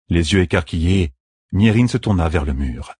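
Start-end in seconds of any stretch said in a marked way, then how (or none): none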